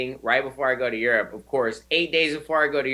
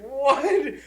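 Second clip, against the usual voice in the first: laughing disbelief; 10 semitones higher than usual. Retold or imitated. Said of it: "I was like".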